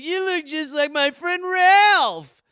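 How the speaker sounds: slow, high, loud put-on surfer; a wide-eyed stoner marvelling; dazed surfer. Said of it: "He's like".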